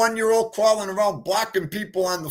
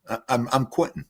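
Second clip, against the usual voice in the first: usual speaking voice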